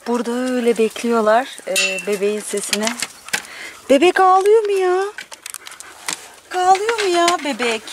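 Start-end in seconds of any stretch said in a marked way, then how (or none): 3.9-7.8 pet talk. Real, not acted. Owner asking pets about the crying baby, high cooing. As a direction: coo it high to an animal.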